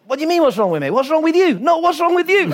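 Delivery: high, loud, heated retort; mock shouting back. [name] hitting back at Kjell in the quarrel, high and loud. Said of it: "So I, now I've".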